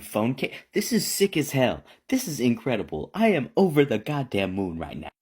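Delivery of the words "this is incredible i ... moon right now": ordinary delivery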